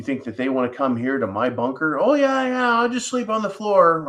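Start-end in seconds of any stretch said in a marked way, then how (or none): 2.0-4.1 high, sing-song mock of a relative who moved in; mocking freeloader voice. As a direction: lampoon the family member happy to crash in the bunker.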